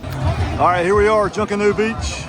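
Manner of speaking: ordinary delivery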